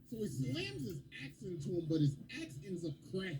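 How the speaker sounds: ordinary delivery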